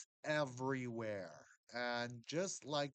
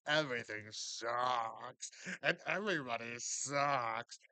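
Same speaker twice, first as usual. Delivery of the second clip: gloomy cynic; 5 dB louder, slower than usual. Retold or imitated. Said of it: "There's people out there, they're like".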